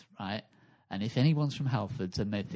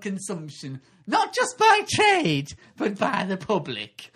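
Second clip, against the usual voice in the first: high, loud, slow hammed-up pitch; pitching entrepreneur; 7 semitones higher, 6 dB louder, slower than usual. Mock contestant pitching a wasp's nest to the dragons, high and loud. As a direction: oversell it like a nervous Dragons' Den pitcher.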